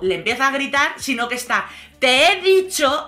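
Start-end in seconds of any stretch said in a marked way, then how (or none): 2.0-3.1 slow, emphatic rude demand; raised, slowed mock scolding. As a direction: imitate someone snapping rudely, each word stretched. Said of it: "hablándole con un tono súper maleducado".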